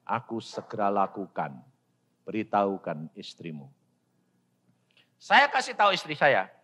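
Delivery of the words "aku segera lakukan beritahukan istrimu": low, hushed divine announcement; voice of God. Retold or imitated. God speaks to preacher in church, low and quiet. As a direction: imitate God, low and measured. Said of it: "Tuhan bicara".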